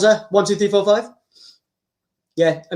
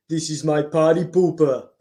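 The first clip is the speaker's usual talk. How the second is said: Arnold impression; 6 semitones lower than usual. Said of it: "shall I do Arnold?".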